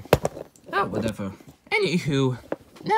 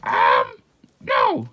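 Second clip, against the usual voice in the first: emphatic refusal; 8 semitones lower, 8 dB louder than usual. Acted. Answering whether a character is in the trailer, emphatic refusal. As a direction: shoot the question down loudly.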